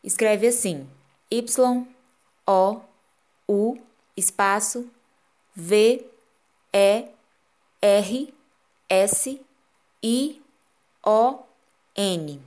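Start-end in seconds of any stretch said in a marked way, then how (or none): 1.3-12.4 letra por letra, bem pausado; spelling it out. Shown as a demonstration. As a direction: soletrando cada letra em voz alta. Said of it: "vou soletrar pra vocês". (soletrando devagar)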